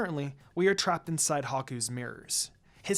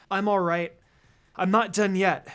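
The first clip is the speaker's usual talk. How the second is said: louder, firm insistence; insisting; 4 semitones higher, 6 dB louder than usual. A narrator's reading. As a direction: push back, determined not to quit. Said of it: "I insisted".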